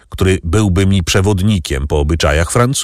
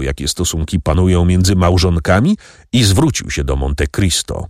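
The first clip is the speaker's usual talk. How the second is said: ordinary delivery, nothing marked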